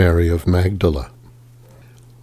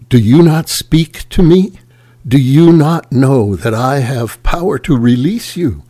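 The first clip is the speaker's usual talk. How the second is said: higher, louder pressing question; urgent demand; 5 semitones higher, 3 dB louder than usual. A narrator's reading. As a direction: push him to answer, voice raised. Pilate presses silent Jesus.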